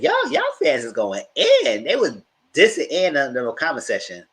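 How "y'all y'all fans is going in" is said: excited exclaim. Real, not acted. Reacting to comment section.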